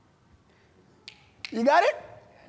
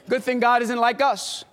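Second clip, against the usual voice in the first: ordinary talk, nothing marked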